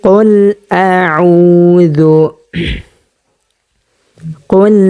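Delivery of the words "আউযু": আউযু said very slowly with the আ pressed from mid-throat; ain from the throat. Shown as a demonstration. Teacher showing students the throat letter, slow stretched আউযু. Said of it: "আইন উচ্চারণ করব তখন হলকের মধ্যখানে".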